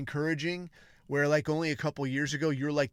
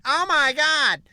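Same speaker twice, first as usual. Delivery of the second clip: mocking gush, much higher and louder; 11 semitones higher, 9 dB louder than usual. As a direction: ham up an over-the-top gushing fan. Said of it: "people would be like".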